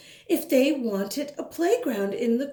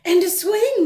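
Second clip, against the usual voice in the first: put-on high, eager little girl asking; bright child voice; 10 semitones higher, 9 dB louder than usual. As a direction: play the curious kid, pitched up. Child character.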